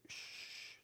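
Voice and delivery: soft unvoiced shush; whispered shh; inner voice hush. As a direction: hush them like a gentle inner voice. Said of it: "the voice inside will say".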